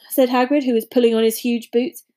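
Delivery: plain reading voice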